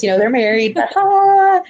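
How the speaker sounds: usual speaking voice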